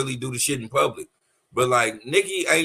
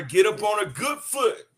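quoting [name]'s line; 7 semitones higher than usual. Retold or imitated. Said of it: "Fell off, told her".

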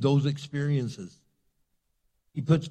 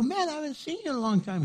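high, rushed happy hello; effusive greeting; 12 semitones higher, faster than usual. Retold or imitated. Pastor mistaking a stranger for an acquaintance, effusive greeting. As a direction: gush quickly as if reunited.

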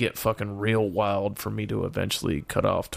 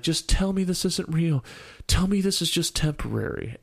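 pleading; 7 semitones higher than usual. Retold or imitated. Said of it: "And then she's like".

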